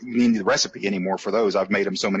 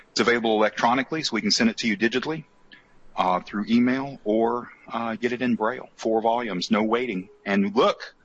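usual speaking voice

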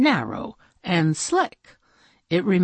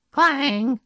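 usual speaking voice

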